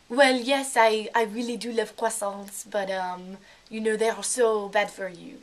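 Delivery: put-on French accent. Shown as a demonstration. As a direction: speak like a French person putting on English. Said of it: "kind of do French accent".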